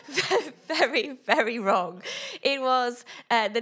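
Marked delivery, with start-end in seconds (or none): 0.1-2.0 repeating it through real laughter; laughing. Real, not acted. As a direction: laugh it out while admitting the mistake. Laughing at her own mistake.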